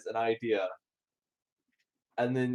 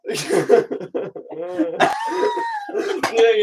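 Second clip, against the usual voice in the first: can't hold back the laugh; breaking into laughter; 7 dB louder, much slower than usual. Real, not acted. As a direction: laugh bursting out. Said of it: "I couldn't hold back the laugh".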